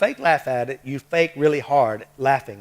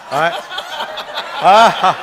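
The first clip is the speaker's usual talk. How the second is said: laughing; 5 semitones higher, 9 dB louder than usual. Real, not acted. Winding down the laughter.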